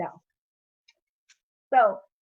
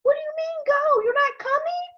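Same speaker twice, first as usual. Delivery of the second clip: friends' voices; 4 dB louder than usual. Retold or imitated. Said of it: "they're like".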